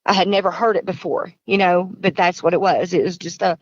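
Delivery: normal talking voice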